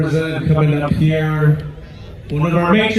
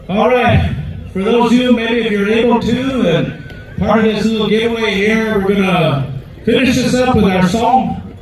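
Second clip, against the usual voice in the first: raised announcer voice to the arena; powwow emcee; 5 semitones higher than usual. Acted. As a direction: invite the whole crowd to dance. Emcee inviting dancers to the song.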